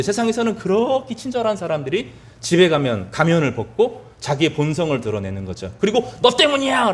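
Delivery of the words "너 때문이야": higher-pitched acted outburst, only slightly raised volume; mock blaming yell. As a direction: imitate someone yelling blame at a spouse. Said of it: "소리를 지르는데". (남 탓하며 소리치는 흉내)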